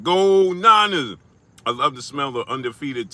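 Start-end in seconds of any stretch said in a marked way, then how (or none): 0.0-1.2 excited cheer. Retold or imitated. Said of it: "He said".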